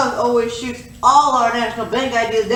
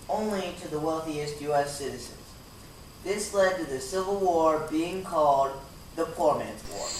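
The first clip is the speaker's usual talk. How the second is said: narration; 6 semitones lower, 10 dB quieter than usual. A narrator's reading. Narrates history between sketches.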